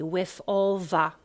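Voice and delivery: "the" said as va; loud, slow WITH ALL THE as wiff all va; Cockney th-as-v. Shown as a demonstration. Coach showing the sound, Cockney th-as-v. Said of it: "that's a V".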